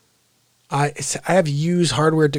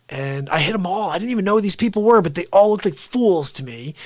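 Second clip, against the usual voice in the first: ordinary talk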